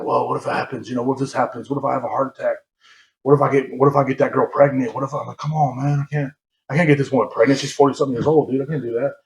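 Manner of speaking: half to himself, fretting quietly; reliving late-night worries under his breath; muttered worries. Retold or imitated. Retold spiral of anxious thoughts.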